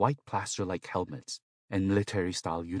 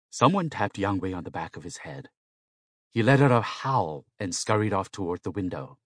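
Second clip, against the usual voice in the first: usual speaking voice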